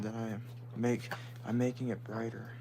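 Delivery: ordinary delivery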